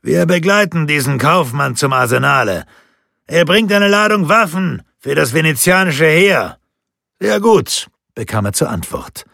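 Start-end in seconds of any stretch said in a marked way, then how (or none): 0.0-6.6 loud, raised-pitch shout; high, forceful call. A narrator's reading. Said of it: "rief er". 7.2-7.9 high, loud call back; brisk loud answer from a distance; shouted reply. A narrator's reading. Guard calls back from the jetty.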